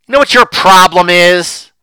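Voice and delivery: loud, high, accusing husband; acted husband's outburst. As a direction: bellow it at your wife like a resentful drinker. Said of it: "he turns and he says".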